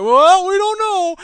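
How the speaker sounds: high, loud, slow mock shrug of the fund managers; mock clueless shrug. Fund managers shrugging off lost pensions, mock clueless. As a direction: play dumb, drawn out and high.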